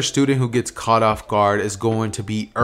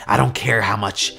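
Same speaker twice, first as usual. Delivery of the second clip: villain boss; 2 semitones lower than usual. Acted. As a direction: put a subordinate in their place, coldly and deliberately. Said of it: "he would say".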